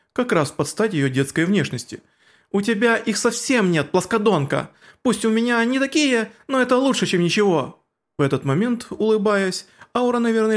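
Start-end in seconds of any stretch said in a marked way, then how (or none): 2.5-7.8 put-on high, haughty girl's voice; haughty vampire girl. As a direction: fire back high and proud. Shalltear's retort, high voice.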